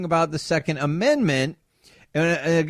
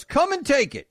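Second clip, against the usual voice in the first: higher, defiant mock shout; retold crowd shout; 10 semitones higher than usual. Crowd guy daring Beto supporters, mock defiant shout. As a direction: fling the challenge back. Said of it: "a guy in the crowd goes".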